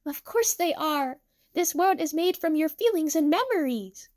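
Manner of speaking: high-pitched, lilting character voice; high, reassuring sing-song delivery; Miku, cheerful. Acted. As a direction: explain it sweetly and happily.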